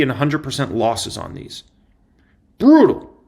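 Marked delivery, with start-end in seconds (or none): 2.6-3.1 one word, much higher and louder; emphatic verdict. Real, not acted. Host judging the shorts' losses, loud emphatic exclamation. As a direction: slam the word down.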